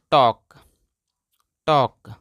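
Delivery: "टॉक" with open aw vowel and silent L; teacher's drill word "टॉक", L left out; correct pronunciation. Shown as a demonstration. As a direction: model "टॉक" with no L sound. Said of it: "इसको पढ़ते हैं".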